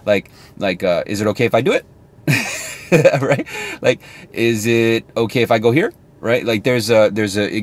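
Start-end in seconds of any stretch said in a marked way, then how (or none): 1.0-1.8 "Is it okay if I do it?" curling up on "it"; permission-seeking rise at the end; rising question tone. Shown as a demonstration. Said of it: "your tone goes up". 4.3-5.9 "here?" lifted at the end like an unsure question; hesitant asking lilt; rising question tone. Shown as a demonstration. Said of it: "your tone goes up".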